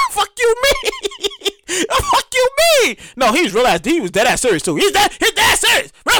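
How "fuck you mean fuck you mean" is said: much higher, laughing in disbelief; incredulous laughing. Real, not acted. Comedian reacting to his dad's answer, laughing disbelief. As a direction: crack up in disbelief.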